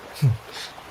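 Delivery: short airy chuckle; a light laugh through the breath. Acted. Friends share bittersweet win. (mahinang tawa)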